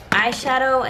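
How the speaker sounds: ordinary talk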